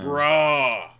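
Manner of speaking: loud, disbelieving bruh; excited. Real, not acted. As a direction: blurt it out, shocked and loud. Reacting to friend not seeing film.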